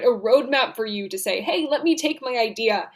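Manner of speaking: ordinary delivery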